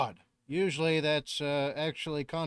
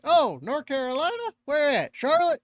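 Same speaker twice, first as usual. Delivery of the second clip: excited southerner; 11 semitones higher, 6 dB louder than usual. Retold or imitated. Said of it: "And they're like".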